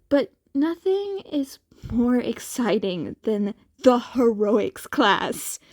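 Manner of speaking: plain reading voice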